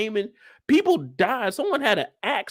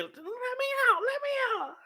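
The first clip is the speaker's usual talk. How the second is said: much higher, softer falsetto plea; trapped women pleading; 7 dB quieter, much faster than usual. Retold or imitated. Voicing the locked-up women, high soft plea. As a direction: beg to be let out.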